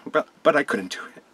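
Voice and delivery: choked up, fighting real tears; crying. Real, not acted. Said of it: "I feel weird crying on camera".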